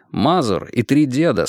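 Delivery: ordinary delivery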